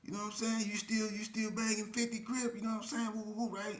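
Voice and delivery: hollering. Retold or imitated. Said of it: "I'm hollering at him like".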